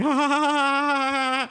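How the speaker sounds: long, high held nervous "aah"; mock nervous laugh. Kid shown the scary scene, nervous laughing wail. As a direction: imitate a freaked-out nervous laugh. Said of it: "nervous laughing".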